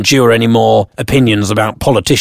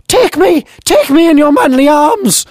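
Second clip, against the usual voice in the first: put-on very high, quavery old-lady cry; hammed-up granny swooning. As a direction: pretend friend crying out amorously, granny voice. Imagined amorous cry repeated.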